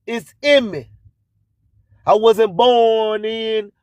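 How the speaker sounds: ordinary delivery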